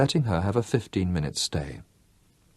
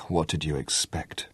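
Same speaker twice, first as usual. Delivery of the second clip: soft, lower, on a sigh; 4 semitones lower, 7 dB quieter than usual. A narrator's reading. Mother answers her son on a soft weary sigh. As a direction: let it out with a tired sigh. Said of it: "Ursula sighed".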